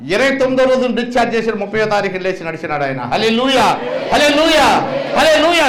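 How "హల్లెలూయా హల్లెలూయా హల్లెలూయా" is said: rising from spoken to loud shout; building hallelujah. Acted. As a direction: build each hallelujah higher and louder.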